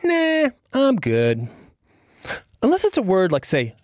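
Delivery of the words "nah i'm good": high, slow, dismissive brush-off. Retold or imitated. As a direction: shrug it off lazily. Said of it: "my brain says".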